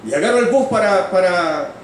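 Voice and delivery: high, projected testimony voice; fervent preaching. Acted. Preacher testifying to the congregation about his conversion, fervent preaching.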